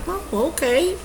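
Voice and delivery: high, soft, rising doubt; retold uneasy thought, lifting into a question; inner questioning. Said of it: "I start getting questions in my mind".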